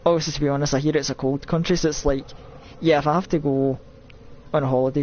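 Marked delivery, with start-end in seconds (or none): none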